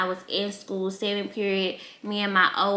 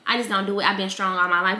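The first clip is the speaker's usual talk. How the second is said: ordinary talk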